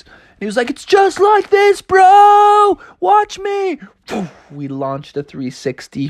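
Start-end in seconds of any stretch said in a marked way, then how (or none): 0.7-3.8 very high, drawn-out showing off; exuberant surfer yell. Acted. Frog showing shark a trick, exuberant shout. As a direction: holler high, peaking loud on "bro".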